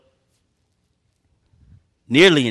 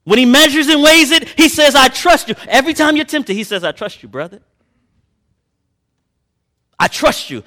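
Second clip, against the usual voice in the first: raised, slow preaching voice, building; fervent preaching; 9 semitones higher, 7 dB louder than usual. Acted. Preacher urging his congregation, in a raised preaching voice.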